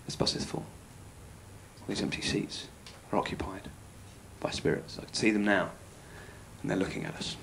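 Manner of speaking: hushed, eerie whisper; spooky whisper. Retold or imitated. Man on the night bus whispering about spirits, hushed and eerie. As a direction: breathe it low like a ghost story.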